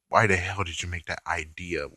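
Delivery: normal talking voice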